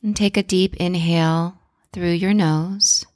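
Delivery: calm guided breathing voice. Acted. Leads a breathing exercise.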